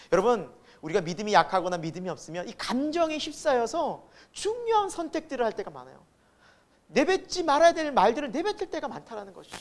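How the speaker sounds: usual speaking voice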